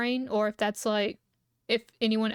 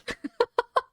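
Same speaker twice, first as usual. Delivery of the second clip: high squealing laugh; 12 semitones higher, 4 dB louder than usual. Real, not acted. Cracking up at chat joke.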